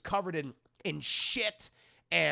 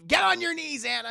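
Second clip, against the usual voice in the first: raised, loud fatherly order; stern command; 9 semitones higher, 5 dB louder, faster than usual. Retold or imitated. Dad orders Anna to kneel, stern command. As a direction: order her down sharply.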